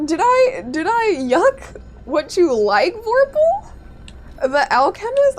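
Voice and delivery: high, loud, drawn-out protest; mock outrage. Real, not acted. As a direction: defend yourself to chat, wounded. Streamer protests chat's accusation.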